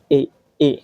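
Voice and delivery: এ as a closer "ay" vowel. Shown as a demonstration. Teacher contrasting vowels for learners, এ as closer "ay". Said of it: "মুখটা একটু এরকম হয়ে যাবে".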